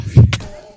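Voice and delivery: loud breathy laugh bursting out; sudden loud laugh; burst of laughter. Real, not acted. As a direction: laugh hard at the joke. Laughs at chat joke.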